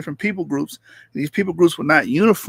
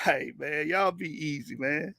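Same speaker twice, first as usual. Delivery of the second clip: much softer, laid-back farewell; quiet sign-off; 2 semitones lower, 12 dB quieter than usual. Real, not acted. Streamer softly tells viewers to take it easy. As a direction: ease right down.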